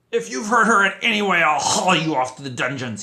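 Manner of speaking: high, loud furious shriek. A narrator's reading. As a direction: shriek the threat at him. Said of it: "he shrieked".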